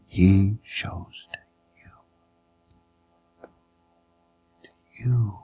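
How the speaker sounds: low, slow whisper; whispered appeal. Real, not acted. Preacher telling each listener mercy is for them, whispered. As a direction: make it personal, barely whispering. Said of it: "whispered personal appeal".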